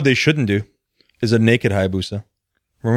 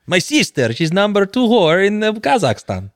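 high, mock Borat accent; Borat impression; 8 semitones higher than usual. Quoting Borat to his cohost in a mock Kazakh accent. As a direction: do a bad Borat. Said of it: "I always end up sounding like Borat".

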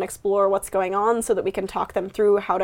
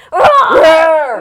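loud shouted roar; dinosaur roar; 6 semitones higher, 9 dB louder than usual. Shown as a demonstration. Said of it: "roar like a dinosaur".

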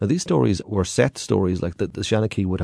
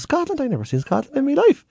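put-on high, amazed old man; astonished stowaway. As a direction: gasp it out in disbelief. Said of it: "He goes".

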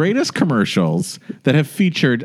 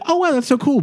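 mock impressed. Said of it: "I was like".

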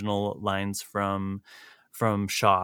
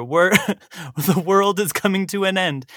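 higher-pitched, mock doomsaying; mock alarm; 7 semitones higher, 3 dB louder than usual. Host voicing every generation's complaint about the young, mock alarm. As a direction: lament it as an overblown complaint.

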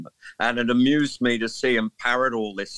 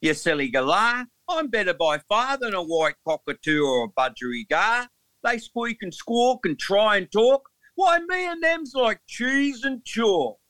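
hammed-up broad Australian accent, climbing higher; 10 semitones higher than usual. Englishman performing Old Man Emu for the host in a hammed-up Australian accent. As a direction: recite it like a proud Aussie bird in a broad Australian accent. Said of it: "performance of it right now with the Australian accent".

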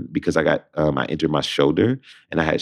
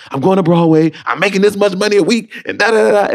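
much higher and louder, thrilled; excited; 6 dB louder than usual. Retold or imitated. Telling the roommate about Broadway, excited. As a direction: gush the news.

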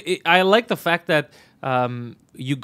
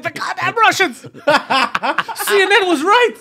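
very high, loud laughing shriek; mock panic; 7 dB louder, much slower than usual. Scared governor fleeing the building, comic panic. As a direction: cry out in hammed-up alarm. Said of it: "'Cause he got scared".

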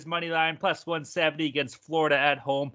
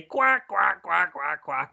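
mock duck quacking; playful imitation of a duck; duck quacks; slower than usual. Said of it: "What does a duck say?".

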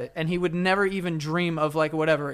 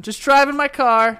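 sarcastic; 9 semitones higher, 5 dB louder, slower than usual. Retold or imitated. Mock innocent retelling of car ride.

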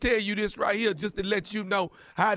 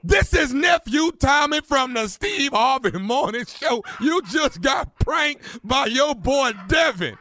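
slow, emphatic, raised announcement; shouted prank reveal; 3 semitones higher, slower than usual. Acted. Host reveals prank to the victim, slow shouted announcement. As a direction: belt out each word of the reveal slowly.

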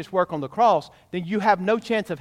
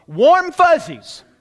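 much higher and louder, slow and mocking; scornful warm fuzzies; 10 semitones higher, 9 dB louder, much slower than usual. Real, not acted. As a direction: drag out warm fuzzies with disdain. Scornful phrase, mocked.

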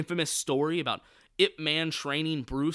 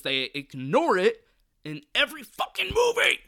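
building to a loud, high-pitched yell; ranting, each word louder and higher; exasperated yell; 9 semitones higher, 7 dB louder than usual. Real, not acted. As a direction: stretch it out and explode in exasperation. Exasperated rant about the franchise.

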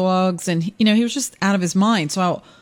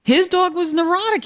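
excited; 11 semitones higher than usual. Real, not acted. Eager start of anecdote.

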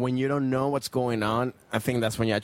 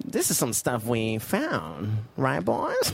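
evil monkey; much slower than usual. Shown as a demonstration. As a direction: play a sly evil monkey rallying the gang. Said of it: "what's the voice you did".

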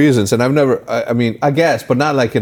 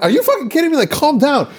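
high, incredulous retold scolding; exasperated scolding. Telling fighters to separate, exasperated. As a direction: push the pitch right up in disbelief.

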